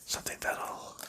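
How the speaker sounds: normal talking voice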